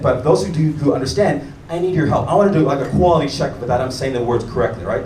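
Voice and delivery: usual speaking voice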